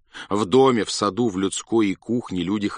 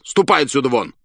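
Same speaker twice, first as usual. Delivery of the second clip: loud, angry general driving him out; an angry general throwing the clerk out; angry order; 5 semitones higher, 6 dB louder than usual. A narrator's reading. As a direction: bark him out of the room. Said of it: "Рассердился генерал".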